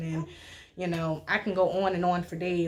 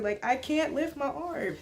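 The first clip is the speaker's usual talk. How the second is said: laughing; 6 semitones higher than usual. Real, not acted. Struggling to move while high.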